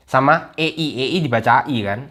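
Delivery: ordinary talk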